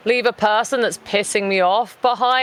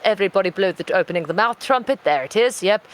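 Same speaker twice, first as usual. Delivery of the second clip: ordinary talk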